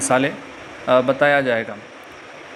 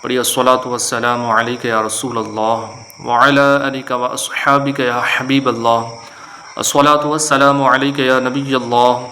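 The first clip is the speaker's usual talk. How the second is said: measured, lower recited invocation before a lesson; formal Islamic opening; 4 semitones lower than usual. Acted. Teacher opening his Qaida lesson with salutations, recited.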